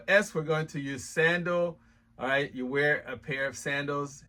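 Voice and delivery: usual speaking voice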